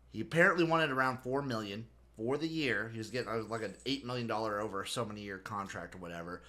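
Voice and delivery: ordinary talk, nothing marked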